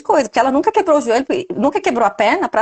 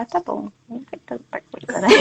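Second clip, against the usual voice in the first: muttered half-laughing, much softer; a soft laughing brush-off under the breath; dismissive inner thought; 9 dB quieter than usual. Retold or imitated. Host voicing the dismissive thought in her head, softly laughing. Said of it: "na minha cabeça, assim, falar".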